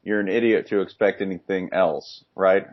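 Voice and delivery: ordinary talk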